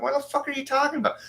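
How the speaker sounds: high, fast, baffled retort; squeaky, rapid, exasperated comeback; incredulous retort. Retold or imitated. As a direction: toss it off in high-pitched disbelief. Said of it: "I'm like".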